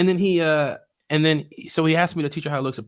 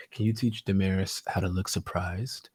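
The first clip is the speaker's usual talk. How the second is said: Prince talking so low; 5 semitones lower, 6 dB quieter than usual. Retold or imitated. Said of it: "he's talking so low".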